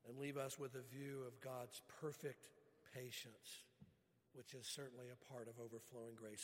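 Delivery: usual speaking voice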